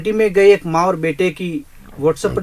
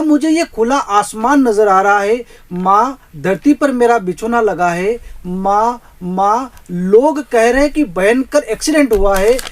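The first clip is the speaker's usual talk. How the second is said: higher, louder, anguished son after the accident; dying son crying out; 4 semitones higher, 5 dB louder, slower than usual. Acted. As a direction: call out to mother from the hospital bed. (हादसे के बाद माँ को पुकारता बेटा)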